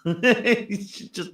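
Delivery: slow words through a real laugh. Real, not acted. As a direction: laugh while trying to speak. Laughing at his own joke.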